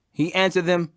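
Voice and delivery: ordinary delivery, nothing marked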